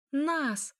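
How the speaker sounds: «Нас» alone, high-pitched and strained; anguished stress on НАС. A narrator's reading. Elya stresses «Нас» to Mikhail, high and anguished. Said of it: "выделила она надрывно".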